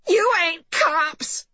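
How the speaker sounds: high, slow, drawn-out declaration; upset mother's high-pitched proclamation; indignant announcement. A narrator's reading. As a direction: announce it high and stretched for all to hear. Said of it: "announced Missus Lanowitz".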